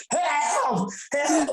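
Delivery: stuck in a puddle, hammed-up high wail for help; mock cry for help. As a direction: wail for help, hamming up the panic.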